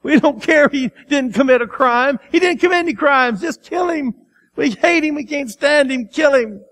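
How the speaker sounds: high, loud mob shouting. A narrator's reading. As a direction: whip it up like an angry crowd. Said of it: "they shouted all the louder".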